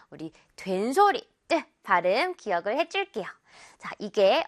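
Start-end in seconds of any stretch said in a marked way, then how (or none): none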